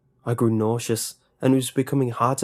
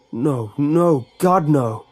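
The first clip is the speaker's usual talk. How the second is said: loud, higher desperate cry; screaming; 4 semitones higher, 7 dB louder, much slower than usual. A narrator's reading. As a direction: scream it at the chained doors. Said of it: "Jeremy screamed".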